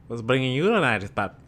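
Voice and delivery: quick comic imitation of an Irish guy; Irish accent. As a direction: put on an Irish accent for a challenge. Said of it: "the Irish guy is like".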